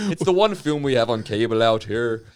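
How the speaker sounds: loud, hammed-up Fargo accent. Northerner blames the only film on cable, Fargo accent. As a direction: lean hard into Fargo vowels. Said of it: "talk like Francis McDormand from Fargo".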